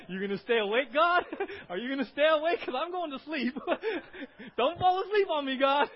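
high, loud pleading through laughter; comic panicked outburst, high-pitched and loud; mock-desperate prayer. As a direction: plead loudly with God, half laughing. Acts out sister's anxious surgery prayer.

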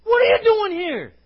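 angry shout, high and loud; loud, indignant scolding; mad older brother. Acted. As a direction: yell it at a kid brother who showed up uninvited. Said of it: "his older brother gets mad".

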